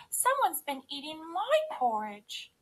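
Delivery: high, gasping mother-bear voice; Mommy Bear. A narrator's reading. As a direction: say it high in shocked disbelief. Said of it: "gasped Mommy Bear".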